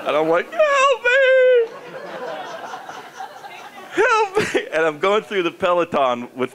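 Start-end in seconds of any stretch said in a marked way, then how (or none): 0.5-4.7 panicked cry. Retold or imitated. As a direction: yelp helplessly in mock panic. Retold panic, stuck in jersey.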